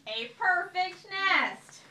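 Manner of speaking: loud, slow, high delight; loud, slow exclamation. A narrator's reading. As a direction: drag it out loud and thrilled. Goose admires nest.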